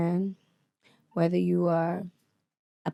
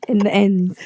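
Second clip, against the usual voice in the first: loud London-slang British accent; 7 dB louder, slower than usual. Shown as a demonstration. Showing off boy talk, loud British accent. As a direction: belt out a mock British accent. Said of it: "That sounds like".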